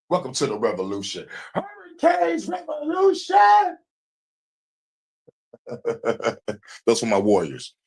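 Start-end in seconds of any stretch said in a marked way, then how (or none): 1.5-3.8 slow, high proclaiming shout; rally shout. Retold or imitated. Leader rallying his warriors with a slow shout. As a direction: bellow the name like a battle cry.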